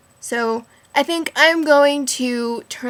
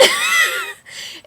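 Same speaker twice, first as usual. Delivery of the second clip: very high shouted burst of laughter; shrieking laugh. Real, not acted. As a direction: howl with laughter. Friend's feet soaked by spigot.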